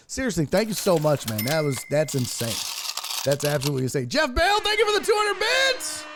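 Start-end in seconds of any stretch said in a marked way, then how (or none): 4.1-6.0 very high, shouted donor thanks; hollered shout-out. Pretend. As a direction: holler the donations at the top of your pitch.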